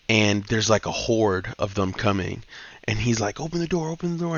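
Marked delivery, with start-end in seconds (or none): 3.4-4.4 higher, pleading repeat; urgent plea. Retold or imitated. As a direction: beg her to open up, pitched high. Trucker begs girl to open.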